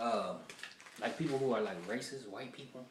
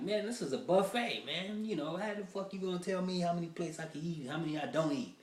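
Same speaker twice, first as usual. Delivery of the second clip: higher, indignant customer complaining; annoyed customer; 6 semitones higher than usual. Retold or imitated. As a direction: protest like a wronged customer. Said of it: "you like".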